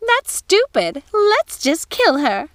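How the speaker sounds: high, loud, slow witchy sister; a gleeful wicked witch; Ruby's voice. Acted. Ruby tells Gothel to just kill the old woman. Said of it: "said Ruby".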